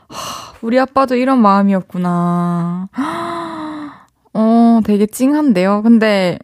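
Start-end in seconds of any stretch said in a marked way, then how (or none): 2.9-3.9 a quiet, touched gasp with a high catch; soft gasp. Real, not acted. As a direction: catch your breath, moved. Moved by the dad's feelings.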